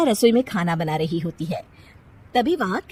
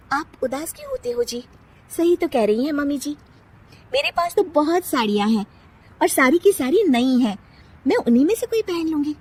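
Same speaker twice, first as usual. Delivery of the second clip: put-on higher young bride's voice, gently coaxing; young wife consoling; 7 semitones higher than usual. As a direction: cheer up the sad husband. Nisha consoles husband. (पति को समझाती नई बहू)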